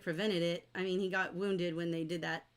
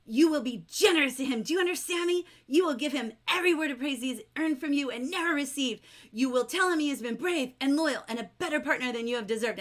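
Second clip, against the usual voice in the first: loud, raised, emphatic scolding; preaching straight fire; 5 semitones higher, 5 dB louder, faster than usual. Acted. Will goes up to Rankin, preaching straight fire. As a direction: lay down the law forcefully. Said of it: "he preaches straight fire".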